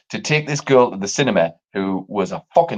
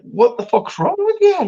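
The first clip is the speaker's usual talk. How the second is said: incredulous. Retold or imitated. Retold outburst at date.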